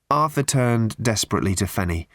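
plain reading voice

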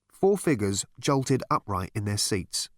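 ordinary delivery

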